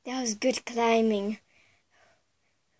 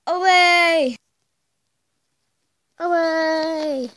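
fading echo call; echoing away; 2 semitones higher, 6 dB louder, much slower than usual. Pretend. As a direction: echo it off into the distance. Dramatic echoing departure.

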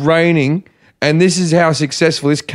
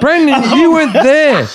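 high, drawn-out cry through laughter; incredulous laughing shout; 10 semitones higher, slower than usual. Real, not acted. Comic interrupting a friend's secondhand story, incredulous and laughing. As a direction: laugh as you call out the absurdity.

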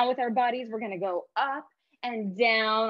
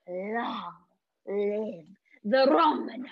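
low villain voice; 3 dB louder, much slower than usual. Shown as a demonstration. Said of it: "say in a low voice".

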